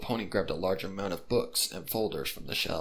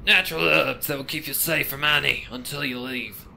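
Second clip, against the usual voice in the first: put-on higher, louder wary old pony; pony warns the detective, raised and wary; mysterious pony; 6 semitones higher, 6 dB louder than usual. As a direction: speak as the secretive exorcist pony. Pony character voice.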